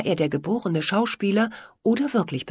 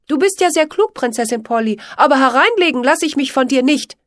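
loud, higher indignant outburst; angry; 8 semitones higher, 8 dB louder than usual. A narrator's reading. Pitt angrily refuses to be tricked by Polly. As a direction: snap at her and storm off. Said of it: "Wütend verließ er den Garten".

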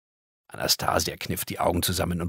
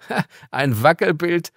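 a higher giggle then amused remark; giggling; 10 semitones higher, 6 dB louder than usual. Acted. Girl giggling at the card, amused. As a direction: giggle at the wobbly picture. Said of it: "kicherte Anastasia".

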